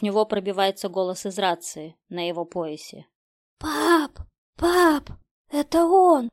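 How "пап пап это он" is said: put-on high, loud, terrified girl's cry; Катя, sobbing. A narrator's reading. Daughter to her father over radio, high frightened cry. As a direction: call for your father in panic. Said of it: "Рыдания Кати смешались с хрипом".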